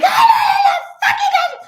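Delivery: very high, loud mock-furious shriek; screaming like Mickey. Daughter retelling the fight over moving in, screaming. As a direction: scream it out, imitating a wit's-end outburst. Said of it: "I started screaming like you, Mickey".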